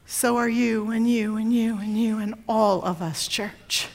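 low, soft, with long weighty pauses; hushed. Real, not acted. Preacher telling the church they are all beloved, hushed. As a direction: drop to a hushed, reverent close. Hushed aside after the climax.

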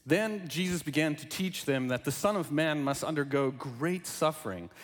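measured, formal Bible reading; scripture reading. Acted. Reads Gospel aloud before sermon.

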